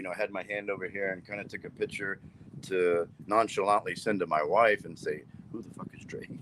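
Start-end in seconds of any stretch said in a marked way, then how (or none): 5.5-6.3 hushed question. Retold or imitated. Texting wife, hushed.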